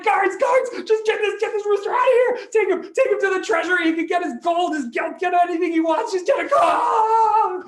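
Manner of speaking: very high, loud, hammed-up pained shouting; panicked screaming. King stung by bees begging guards, panicked shouting. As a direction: scream for help as if being stung all over.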